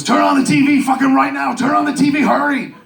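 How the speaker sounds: high, loud, frantic phone call; urgent shout. Retold or imitated. Friend phones with urgent orders to switch on TV, urgent shouting.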